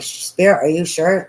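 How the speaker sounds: high, loud, startled "are you sure"; startled doubt. Retold or imitated. Reader startled, questioning Spirit about the card. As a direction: call out in disbelief. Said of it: "And I'm like".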